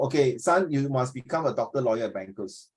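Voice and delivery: ordinary talk, nothing marked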